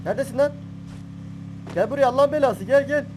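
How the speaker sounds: angry shout. Real, not acted. Gamer yelling at an enemy player, angry shout. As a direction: bark at him to come here.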